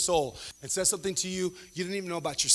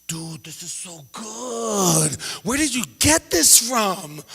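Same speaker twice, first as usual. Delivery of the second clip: slow, awed then louder question; amazed friend; 3 semitones higher, 3 dB louder, much slower than usual. Retold or imitated. Kevin amazed by the burrito, slow and awed. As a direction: savor the bite in disbelief.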